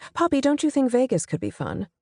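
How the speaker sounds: lifted, pointed query to an employee; leading question. A narrator's reading. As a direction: ask it high, inviting agreement. Boss puts leading question to narrator.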